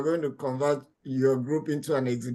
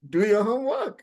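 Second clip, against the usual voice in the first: higher-pitched playful scolding; mock admonishing; 5 semitones higher than usual. Host warns students who want to pass exams, mock admonishing. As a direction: hammer it home like a teasing teacher.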